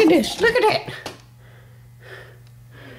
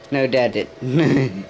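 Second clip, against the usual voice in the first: usual speaking voice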